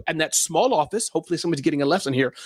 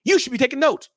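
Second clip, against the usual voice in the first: scolding big boss; 8 semitones higher than usual. Retold or imitated. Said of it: "the big boss".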